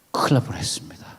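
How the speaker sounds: a hushed, secretive remark; whispered aside. Real, not acted. Preacher joking to the congregation about a near miss, in a whisper. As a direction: drop to a whisper for the punchline.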